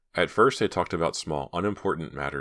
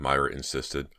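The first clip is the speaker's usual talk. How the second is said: plain reading voice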